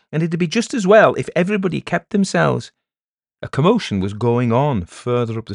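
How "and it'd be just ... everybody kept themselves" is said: loud, high and quick telling-off; scolding. Acted. Elder ordering villagers to keep to themselves, scolding. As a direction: hurry them away, voice raised.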